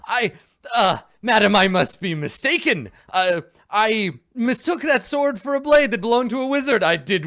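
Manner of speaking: high, loud, flustered pleading; Sir Bluto groveling. Acted. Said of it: "some very good groveling".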